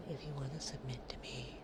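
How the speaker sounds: playful whispered aside; teasing whisper. Real, not acted. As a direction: drop to a sly whisper.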